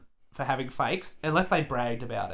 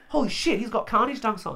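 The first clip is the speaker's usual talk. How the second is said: higher, startled "Holy shit" as he first spots the shoes; first double take, still half to himself; surprised; 7 semitones higher than usual. Retold or imitated. Seeing his own shoe design on the subway, startled. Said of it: "and I'm like".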